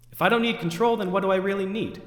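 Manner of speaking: normal talking voice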